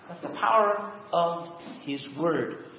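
ordinary talk, nothing marked

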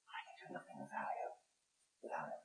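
near-whisper, very low; quiet, solemn admission of helplessness; hushed confession. Real, not acted. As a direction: drop to a barely audible, humbled murmur. Hushed repeat confessing dependence on God.

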